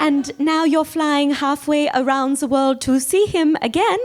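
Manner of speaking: high, slow, incredulous German friend. Retold or imitated. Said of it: "I told my German friend".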